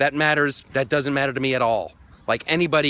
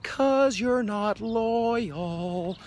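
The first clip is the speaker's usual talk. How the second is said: slow, high sing-song taunt; drawn-out singsong mock of the president; sing-songy; 9 semitones higher, much slower than usual. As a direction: croon it like a playground tease. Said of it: "I had to do that one sing-songy".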